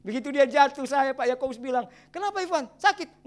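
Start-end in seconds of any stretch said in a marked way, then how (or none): 2.1-3.3 concerned call. Retold or imitated. Father calls to fallen son.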